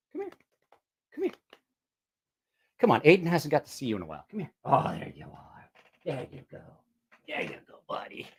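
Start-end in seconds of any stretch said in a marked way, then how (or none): 4.6-8.3 breath-soft whisper to a pet; tender pet talk. Real, not acted. Owner greeting Shopcat on arrival, tender whisper. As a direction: murmur it very softly to soothe. Murmurs to the shop cat.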